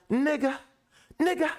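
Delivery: high squeaky mom cussing; imitating mom cussing. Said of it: "So she be like".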